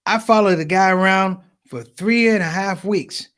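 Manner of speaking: ordinary delivery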